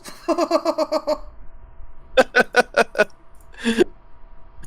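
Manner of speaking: bursting into high laughter; big cackling laugh; loud high laugh. Real, not acted.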